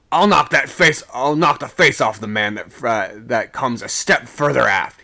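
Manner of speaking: bullying shouted threat; angry shout. A narrator's reading. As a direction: shout it as a furious warning. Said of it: "he shouted".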